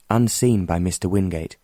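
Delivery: plain reading voice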